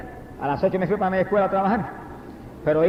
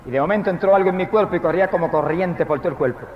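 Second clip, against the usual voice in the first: usual speaking voice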